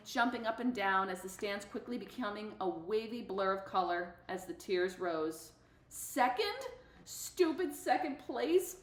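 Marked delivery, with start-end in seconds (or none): 5.9-8.8 high, slow stunned question; can't believe it's second; disbelief. Real, not acted. Runner stunned at losing first, high and incredulous.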